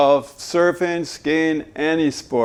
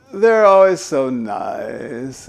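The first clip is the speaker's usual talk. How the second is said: usual speaking voice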